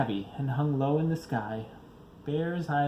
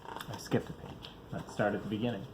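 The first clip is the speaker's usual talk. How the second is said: whispered aside; 4 semitones lower, 8 dB quieter, faster than usual. Real, not acted. Aside to children mid-reading.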